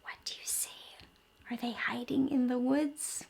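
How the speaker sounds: put-on hushed question to children; whisper. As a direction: whisper as if the dinosaurs might hear. Asking kids about hidden dinosaurs.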